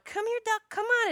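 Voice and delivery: high, sing-song call to a duck; high coaxing call to a wounded duck. Retold or imitated. Calling the hurt duck over.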